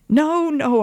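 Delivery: high, loud, urgent cry. A narrator's reading. Wife stopping husband sending his family away, crying out. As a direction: cry out the refusal, pitch leaping. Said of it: "I cried".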